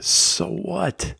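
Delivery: normal talking voice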